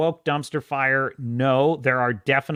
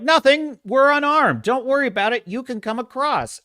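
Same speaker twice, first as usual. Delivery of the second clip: much higher, louder, overly cheery call; falsely innocent call; 9 semitones higher, 4 dB louder than usual. Retold or imitated. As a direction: call out a fake reassurance. Strangers lying, calling back.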